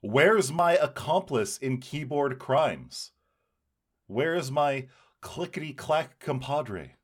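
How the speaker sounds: ordinary talk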